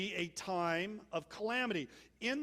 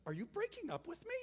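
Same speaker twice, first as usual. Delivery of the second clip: much higher, fast, upset question; shocked and near tears, quicker; upset girl; 10 semitones higher, 5 dB quieter, faster than usual. Retold or imitated. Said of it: "the girl breaks out in tears".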